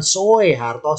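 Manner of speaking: SOEHARTO said as "So-e-harto"; hard-to-say old spelling. Shown as a demonstration. Teacher shows what is hard for the tongue, split "So-e". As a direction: sound O and E apart in SOEHARTO. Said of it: "Lidah kita mengucapkan Soeharto susah".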